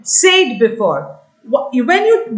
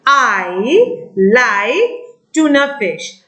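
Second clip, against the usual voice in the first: slow dictation; 2 semitones lower, much slower than usual. Shown as a demonstration. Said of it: "within inverted commas".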